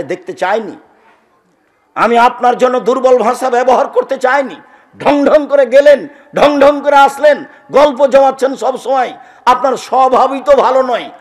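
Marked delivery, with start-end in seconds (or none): 1.9-11.1 loud, high scolding sermon voice; fiery admonishing preacher; preaching at full force. Acted. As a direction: rebuke the crowd like a preacher.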